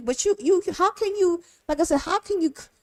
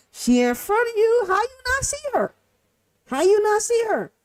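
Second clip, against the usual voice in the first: exasperated disbelief; 8 semitones higher than usual. Retold or imitated. Exasperated at players missing passes.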